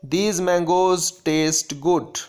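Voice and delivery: very slow, measured reading of a grammar rule. Shown as a demonstration. Teacher dictating a grammar rule to students, very slow. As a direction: dictate the rule slowly so students can copy it. Reads example sentence.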